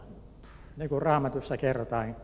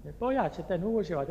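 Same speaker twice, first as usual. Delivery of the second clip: ordinary delivery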